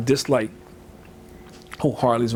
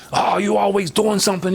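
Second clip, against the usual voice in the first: higher-pitched fed-up complaint; exasperated parent; 7 semitones higher than usual. Acted. Parent fed up with a child's behavior, exasperated. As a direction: scold with mild exasperation. Contrast to calm talk with child.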